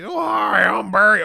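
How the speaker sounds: high, loud mock introduction; Barry. Chicken thief introducing himself to the wife, showy. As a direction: imitate a man eagerly introducing himself. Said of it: "he parted his hair and he".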